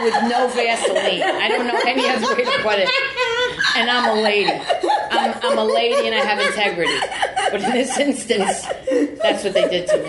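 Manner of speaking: laughing through the words; giggling while telling it. Real, not acted. Telling her friend about the studio, laughing.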